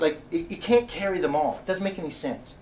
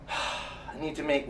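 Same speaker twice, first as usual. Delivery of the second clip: whispered self-talk; 3 semitones lower, 10 dB quieter, slower than usual. Real, not acted. Alone in car, collecting himself.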